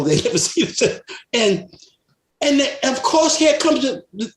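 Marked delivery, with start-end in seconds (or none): none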